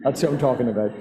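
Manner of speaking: normal talking voice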